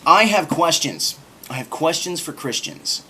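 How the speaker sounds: slow, loud, grand title voice; dramatic announcement. Shown as a demonstration. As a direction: announce it like a big show title. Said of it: "that's just a cool sounding piece of rhetoric".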